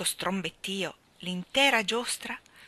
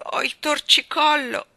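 put-on higher, louder child's excuse; self-justifying excuse; 7 semitones higher, 10 dB louder than usual. A narrator's reading. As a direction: blurt an excuse to cover tears. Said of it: "disse per giustificarsi".

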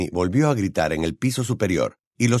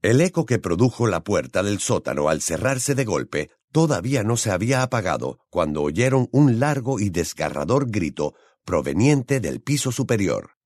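ordinary delivery

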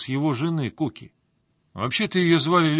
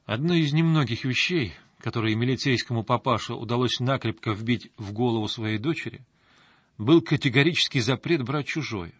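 ordinary delivery

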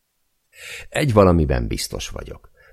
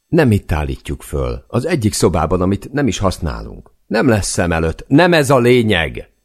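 higher and louder, building to a yell; shouting; 7 semitones higher, 6 dB louder than usual. Acted. Husband yells at wife about the tree, building to a shout. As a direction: blow up and yell the last words. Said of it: "Üvöltve vágok közbe".

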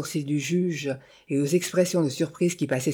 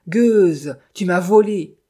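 loud, acted accusation; furious cry; 3 semitones higher, 8 dB louder, slower than usual. A narrator's reading. Widow accusing Sophie of theft, crying out furiously. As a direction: hurl it out in raging outburst. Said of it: "cria-t-elle".

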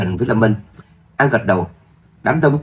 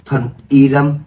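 a sudden surprised exclamation, raised; startled cry; 4 semitones higher, 4 dB louder, slower than usual. A narrator's reading. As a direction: blurt it out, caught off guard. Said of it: "giật mình kêu lên".

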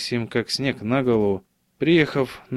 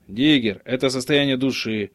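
plain reading voice

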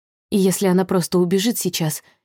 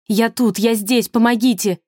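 higher, urgent cry for help; calling for help; 4 semitones higher than usual. A narrator's reading. Trapped Sasha calls to a silhouette outside, urgent cry. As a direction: call out to be heard through the glass.

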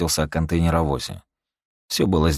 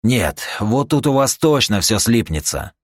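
higher-pitched, mildly outraged retort; indignant refusal; 6 semitones higher, 3 dB louder than usual. Acted. Eugene rejecting the demand to pay, indignant. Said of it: "возмутился Юджин".

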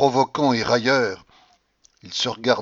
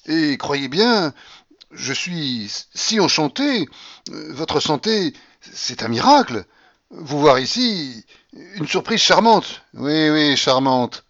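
slow, higher, syrupy compliments; De Griers fawning over the grandmother's health; oily flatterer; 5 semitones higher, 6 dB louder, slower than usual. A narrator's reading. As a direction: lay the charm on thick.

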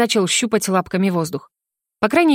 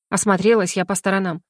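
ordinary delivery

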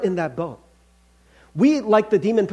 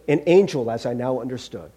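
usual speaking voice

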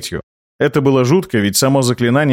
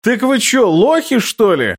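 much higher, mocking bellow; roaring; 9 semitones higher, slower than usual. A narrator's reading. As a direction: roar it in scornful disbelief. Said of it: "взревел Локи".

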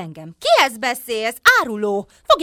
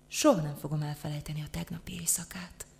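hushed, lower, near-whisper; dreamy reminiscence; 5 semitones lower, 11 dB quieter than usual. Acted. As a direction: recall a night of love softly. Dreamy memory of night of love. (álmodozó, suttogásba hajló hang)

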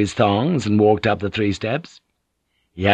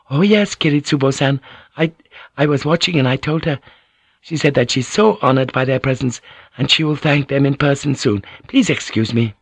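higher-pitched, respectful servant voice; courteous maid; 5 semitones higher than usual. Acted. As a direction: play a maid dutifully reporting. Maid reassures the ladies.

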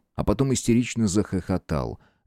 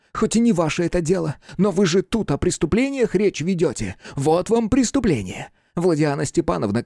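high, impassioned account; agitated telling; 9 semitones higher than usual. A narrator's reading. Hunchback telling investigator his beloved's misfortune, agitated. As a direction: press the story on with heated feeling.

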